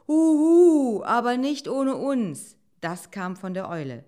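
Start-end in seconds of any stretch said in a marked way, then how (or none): 0.1-2.6 high, loud owl call; hoot, then call out emphatically. Acted. Owl announcing itself to the friends, high hooting call.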